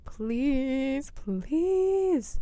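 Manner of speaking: overplayed desperate begging; mock begging. Vlogger begging the film character, hammed-up whine.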